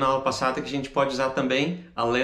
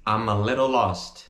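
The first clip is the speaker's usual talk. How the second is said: "I'm a little lost" as a quicker linked run; joined up; 3 semitones lower than usual. Shown as a demonstration. Teacher repeats the reduced phrase, smoothly joined. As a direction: run "amma little lost" together, a little lower.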